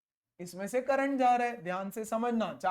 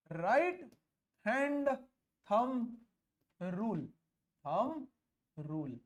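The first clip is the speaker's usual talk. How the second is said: long pause after each word; isolated words, spaced-out dictation; dictating word by word; much slower than usual. Real, not acted. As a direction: give note-takers time on every word. Dictates the rule's name for copying.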